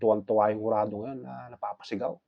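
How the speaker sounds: ordinary talk, nothing marked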